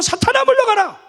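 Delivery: high, loud commanding cry; rallying the church to shout it. Shown as a demonstration. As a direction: shout it out boldly. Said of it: "크게 한번 외쳐봅시다".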